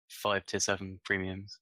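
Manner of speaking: usual speaking voice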